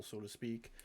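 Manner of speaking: usual speaking voice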